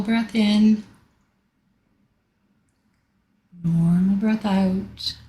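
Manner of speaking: slow, drawn-out breathing cues; long stretched words, unhurried pauses between; guided breathing. Real, not acted. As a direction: lead a group breath slowly. Leads guided breathing exercise.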